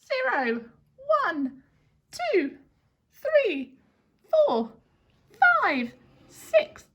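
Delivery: high squeaky counting; squeaky mouse. Pretend. Teacher counting along with the class, squeaky mouse voice. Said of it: "squeaky mouse voice for me".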